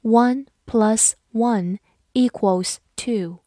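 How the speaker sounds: slow, deliberate sentence model; slow teacher read-aloud. Shown as a demonstration. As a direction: read it slowly word by word. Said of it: "好，慢慢来读".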